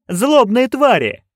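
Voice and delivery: high, slow shouted chant. A narrator's reading. Witches shouting their line back at the director, loud and high. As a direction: bellow it on command. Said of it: "witches shout on command".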